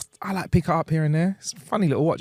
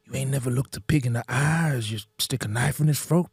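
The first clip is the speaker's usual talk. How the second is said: toothless hillbilly. Acted. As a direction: do a backwoods Southern hillbilly witness. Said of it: "some fucking proper hillbilly with, like, no teeth".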